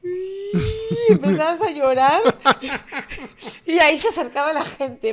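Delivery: can't hold back the laugh. Real, not acted. Telling the cohost about crying as a kid, laughing.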